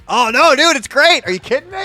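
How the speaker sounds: excited. Real, not acted. Excited over the music.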